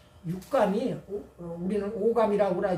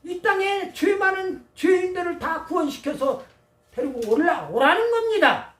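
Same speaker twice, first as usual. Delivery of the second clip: raised, emphatic sermon delivery; forceful preaching; 7 semitones higher, 5 dB louder than usual. Real, not acted. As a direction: preach it out, pitched up and punchy.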